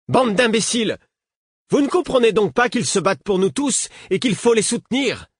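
high-pitched, indignant outburst; angry berating. A narrator's reading. Woman berating those who doubt her rebels, angrily. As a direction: scold them in exasperation, pitched well up.

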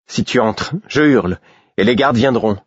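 Molly threatening from behind the shutters; threat to scream. A narrator's reading. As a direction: warn him off sharply, voice climbing. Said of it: "j'entendis la voix de Molly s'élever".

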